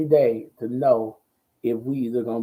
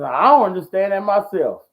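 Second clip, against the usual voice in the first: higher, louder exasperated punchline; mock exasperation; 7 semitones higher, 6 dB louder than usual. Pastor about big fancy words, comically exasperated. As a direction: imitate a baffled pastor, pushing it up and out.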